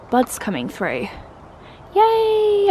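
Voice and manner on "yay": much higher, louder little yay; excited cheer. Real, not acted. She spots new hydrangea buds in the garden and lets out a high excited cheer. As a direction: squeal a happy yay.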